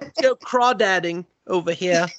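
high, put-on British accent; mock British voice. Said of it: "I don't know why they're British".

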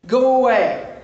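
loud, hard "go away" shout; acted demon cry to leave. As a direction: drive them off with a yell. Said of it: "He cried out at the top of his voice".

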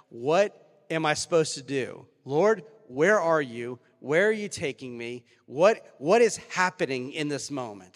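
loud, slow anguished plea to heaven; desperate cry to God. Pretend. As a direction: cry out the questions, dragging them out. Preacher voicing a desperate prayer.

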